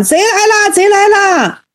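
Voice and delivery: high mock yell, no real volume; voiced elder crying out in alarm; high-pitched cry of alarm. A narrator's reading. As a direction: call out "thief!" high and urgent, as a storyteller. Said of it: "长老高喊".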